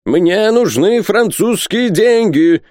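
high, drawn-out shouted demand; shouting. A narrator's reading. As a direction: yell it slowly at a high pitch. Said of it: "кричал Пальмирен Розет".

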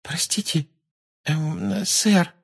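high, hesitant schoolgirl question; timid. Acted. Pupil shyly interrupting the teacher, timid and high. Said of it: "робко спросила Парвати".

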